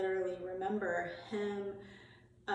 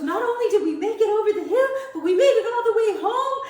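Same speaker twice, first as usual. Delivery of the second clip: high, loud, fast through giggles; retelling grandma's victory while laughing; laughing triumph; 13 semitones higher, 14 dB louder than usual. Retold or imitated. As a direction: deliver the punchline cracking up.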